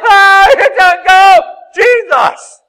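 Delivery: slow, high, loud mock scream; terrified scream. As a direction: shriek in mock terror at a ghost. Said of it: "they're like".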